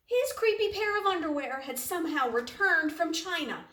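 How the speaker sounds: ordinary delivery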